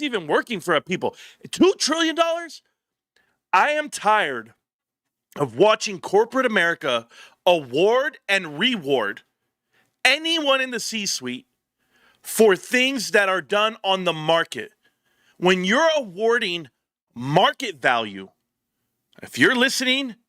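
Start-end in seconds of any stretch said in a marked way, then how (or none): 1.5-2.6 incredulous. Real, not acted. Outraged disbelief. 3.5-18.3 slow emphatic. Real, not acted.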